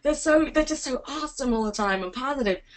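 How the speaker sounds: high, awed British reaction; mock British awe. Mocking British reaction to Americans, high and awed. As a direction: gasp at how positive Americans are. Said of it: "And we think".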